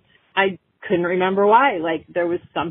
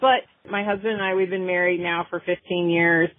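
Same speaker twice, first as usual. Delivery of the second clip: usual speaking voice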